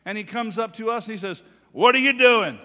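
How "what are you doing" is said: loud, high blurted demand; brusque old man. Retold or imitated. Old man accosting two preachers at their table, loud brusque demand. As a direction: bark the question at strangers. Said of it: "He's about eighty years old".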